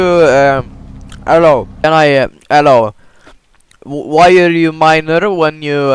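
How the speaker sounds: ordinary talk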